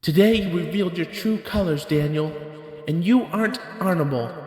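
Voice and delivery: high, clearly shifted accusing woman's voice; a high scornful voice that climbs and slows on the last words; Abigail's cutting words. Acted. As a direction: deliver the final rebuke as Abigail, rising to the end. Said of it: "one of the last things Abigail said".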